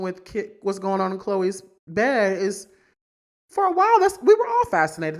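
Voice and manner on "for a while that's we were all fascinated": excited outburst. Real, not acted. Gossip rant, sudden peak.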